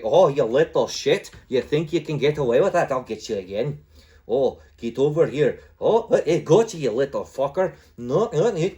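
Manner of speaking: hammed-up Irish cursing at an escaping player; bad Irish accent. Shown as a demonstration. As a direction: taunt them in a badly faked Irish accent. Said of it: "like a really shitty Irish impression".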